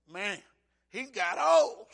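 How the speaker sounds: put-on loud exclaimed old; blurting it out to his wife; mock shout. Preacher telling wife Lynn about Obama, loud exclaim.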